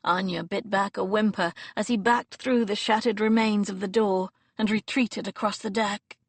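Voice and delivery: plain reading voice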